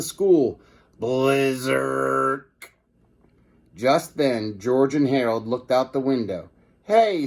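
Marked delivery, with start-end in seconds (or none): 1.0-2.4 sci-fi ray zap word; zapping sound effect. Acted. Shrink ray fires. 6.8-7.3 put-on high surprised boy call; excited kid. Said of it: "said George".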